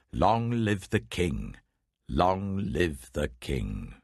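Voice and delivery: a courtier's ceremonial cry, stretched; slow royal acclaim. Acted. Hushai hailing Absalom as king, slow ceremonial acclaim. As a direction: hail the new king, slow and drawn out.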